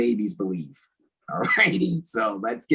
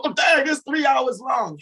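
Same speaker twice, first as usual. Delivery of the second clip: high, slow mocking complaint; mock whining viewer; 3 dB louder, slower than usual. Host mocking viewers who complain about length, high mock whine. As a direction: imitate a bored viewer whining, pitched up high. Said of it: "So people".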